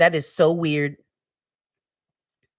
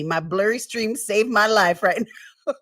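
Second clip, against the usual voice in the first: normal talking voice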